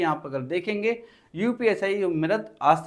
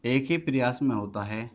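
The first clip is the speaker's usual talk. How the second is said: teacher dictating a definition to the class; slow dictation; 4 semitones lower than usual. Acted. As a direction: dictate word by word with long pauses so students can write.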